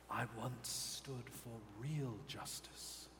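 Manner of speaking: soft, hushed, regretful demon whisper; sorrowful Asmodeus. Acted. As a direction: whisper it like a fallen god's confession. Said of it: "you hear in your minds Asmodeus say".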